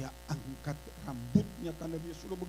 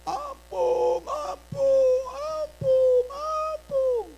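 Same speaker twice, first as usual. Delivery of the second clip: high, loud goat-like wailing cry; bleating like a goat; 12 dB louder, much slower than usual. Retold or imitated. The possessed girl crying for mercy, bleating like a goat. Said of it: "berteriak seperti kambing".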